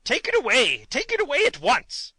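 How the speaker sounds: high, loud frantic king; panicked shout. A narrator's reading. King leaping from the egg under his throne, shouting. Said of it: "he shouted".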